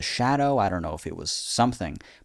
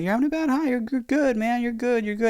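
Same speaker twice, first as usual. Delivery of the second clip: reassuring dad. Retold or imitated. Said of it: "he's like".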